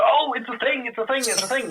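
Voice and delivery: high, imitated fan excitement; excited fans. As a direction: play a fan lighting up at a familiar bit. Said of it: "so that people go".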